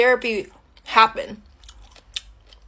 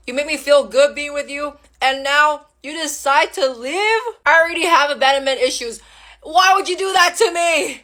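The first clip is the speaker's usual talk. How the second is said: hurt outburst; 3 semitones higher, 3 dB louder than usual. Acted. Woman asks ex why he left, building hurt outburst. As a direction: build to a raised, wounded question. Confronts ex about leaving.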